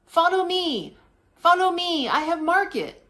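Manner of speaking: high, insistent vendor's broken English. Retold or imitated. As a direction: coax the tourist in broken English. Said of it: "She goes".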